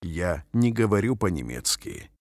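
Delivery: ordinary talk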